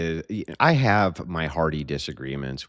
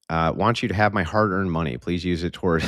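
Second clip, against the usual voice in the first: ordinary talk, nothing marked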